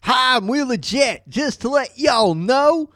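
slow, high-pitched Southern snitch voice; Southern accent. Retold or imitated. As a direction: play a folksy Southern informant, slowly. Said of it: "That sounds like a real Southern name".

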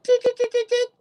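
high ticking noise; imitated clock ticking. Shown as a demonstration. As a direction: mimic a clock's tick. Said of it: "that kind of thing".

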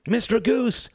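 very high calling-out; urgent shout. A narrator's reading. Badger pounding on Goose's door, high urgent shout. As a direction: holler up at a neighbour's house. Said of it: "shouted Mister Badger".

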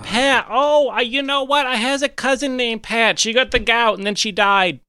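high-pitched in-character customer; Aunt Susan character voice. Acted. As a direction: put on a high character voice asking for the manager. Plays Aunt Susan in the game.

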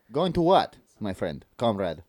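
slow mock-Russian accent. Shown as a demonstration. Co-host says go on, slow mock Russian accent. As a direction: draw it out as a put-on Russian. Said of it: "Oh, Russian".

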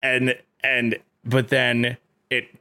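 ordinary talk

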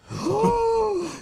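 high, loud gasp of shock; sharp gasp. Acted. Host reacts to the fish being hurt with a shocked gasp. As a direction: gasp as if something awful happened.

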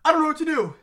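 loud exasperated cry; exasperated outburst. Real, not acted. Friend exasperated by the joke, a loud outburst. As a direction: throw up your hands.

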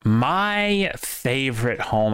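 ordinary talk

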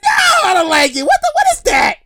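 high, hammed-up outraged protest; sputtering high-pitched refusal; mock appalled visitor. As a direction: squeal your disgust back at the host. Visitor angrily answers the host.

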